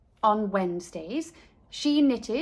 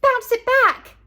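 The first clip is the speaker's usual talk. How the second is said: high loud squeaky call; squeak; 12 semitones higher, 9 dB louder than usual. A narrator's reading. Girl tosses ball to nanny, high loud squeak. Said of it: "and squeaked".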